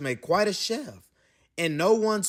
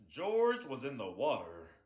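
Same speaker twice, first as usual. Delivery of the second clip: plain reading voice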